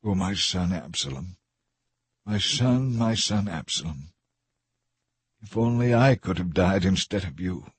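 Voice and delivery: soft, grieving lament fading away; a king grieving for his dead son, softly weeping; crying. A narrator's reading. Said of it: "he cried".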